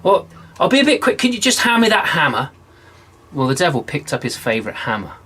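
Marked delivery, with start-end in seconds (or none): 0.0-2.5 much higher, fast wheedling; eager wheedling. Acted. John sweetly asking the devil, high and quick. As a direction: rush the sly favour.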